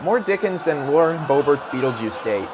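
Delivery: ordinary talk, nothing marked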